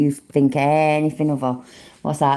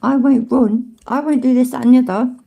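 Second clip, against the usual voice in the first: raised, louder whiny protest of innocence; mock suspect; 6 semitones higher, 3 dB louder, faster than usual. Streamer mocking what arrested people tell cops, pleading. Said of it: "and all of them say".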